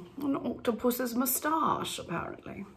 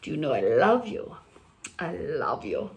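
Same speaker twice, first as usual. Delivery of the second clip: Milanese lover; 6 semitones lower than usual. Acted. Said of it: "But I'm in Milan now".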